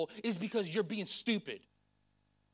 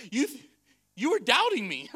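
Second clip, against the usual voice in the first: louder, swooping mock disbelief; comical God; 4 semitones higher, 10 dB louder than usual. God to a doubter, comical mock disbelief. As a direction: play God as comically taken aback. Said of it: "I feel God is a little comical".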